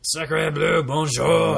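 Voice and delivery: hammy French exclamation; French accent. Pretend. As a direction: toss out French like a cartoon Frenchman.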